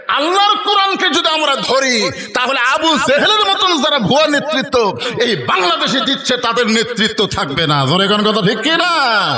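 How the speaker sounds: high, rousing rally cry; full-force preaching. Real, not acted. Preacher warns Bangladesh's false leaders before a crowd, full-force preaching. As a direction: rouse the crowd with high, ringing proclamation.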